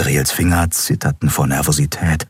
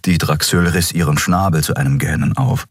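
usual speaking voice

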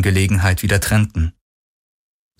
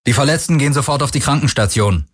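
quick, higher-pitched instruction; giving orders; 6 semitones higher, faster than usual. A narrator's reading. Parker directs his people aboard, giving orders. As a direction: direct the group briskly. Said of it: "wies Parker seine Artgenossen an".

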